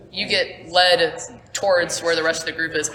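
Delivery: usual speaking voice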